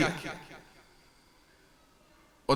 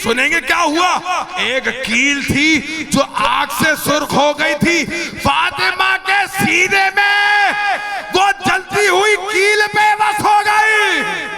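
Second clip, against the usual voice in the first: raised, sustained mourning sermon voice; impassioned lament preaching; 10 semitones higher, 6 dB louder than usual. Acted. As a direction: preach the tragedy at full force, climbing in pitch.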